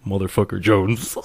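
lightly imitated movie-line echo, rising at the end; a hint of someone echoing a film quote; parroting. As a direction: parrot the line back into a silent theater. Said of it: "Paul just parroting into the theater".

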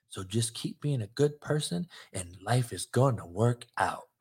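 soft, low, mocking sweet-nothings whisper. Host mocking fans who want comfort, in a soft whisper. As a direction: coo it like a fake comforter. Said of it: "I gotta whisper motherfucking sweet nothings in your ear".

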